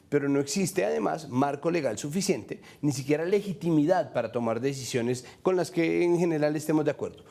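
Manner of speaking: usual speaking voice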